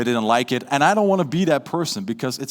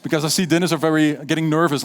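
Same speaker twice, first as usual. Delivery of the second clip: ordinary talk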